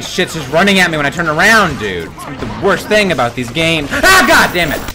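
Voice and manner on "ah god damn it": high, loud startled curse; sudden frightened yell; screaming. Real, not acted. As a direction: scream it in alarm. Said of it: "I'm sorry for screaming".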